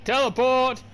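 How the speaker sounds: loud, high and triumphant; triumphant shout. Pretend. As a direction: shout it like a victory cry.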